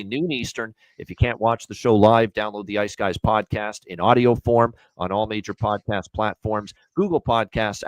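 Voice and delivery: ordinary delivery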